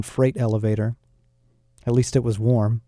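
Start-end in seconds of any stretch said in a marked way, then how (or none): none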